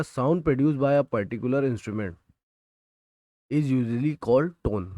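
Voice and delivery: reading the textbook line word by word, slowly; slow, deliberate dictation for students to copy. Shown as a demonstration. Teacher dictating a textbook definition to students, slowly.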